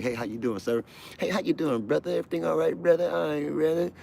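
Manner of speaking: high, chummy greeting; mock buddy-buddy guard; high friendly guard calling brother.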